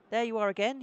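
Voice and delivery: pleased declaration. Acted. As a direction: greet him like an old rival you enjoy. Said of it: "he declares with pleasure".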